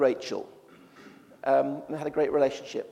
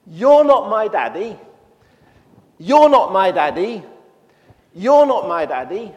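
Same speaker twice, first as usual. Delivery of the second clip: put-on loud, slow, higher child's yell; child shouting; 4 semitones higher, 12 dB louder, slower than usual. Little girl shouting at strangers at the airport, loud. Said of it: "starts shouting out top of her voice".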